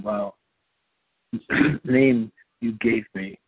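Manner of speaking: ordinary talk, nothing marked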